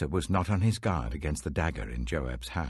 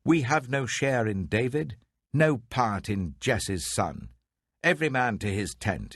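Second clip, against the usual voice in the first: raised, higher rallying cry, slowing on the middle line; rebel's shout; 6 semitones higher than usual. Acted. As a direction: call the tribes away from David. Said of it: "He sounded the trumpet and shouted".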